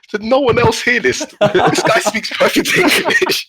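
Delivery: high-pitched baffled retold outcry; comic squeal of bewilderment, slowing at the end; incredulous. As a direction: appeal to the room in astonished disbelief. Retold disbelief that nobody else heard.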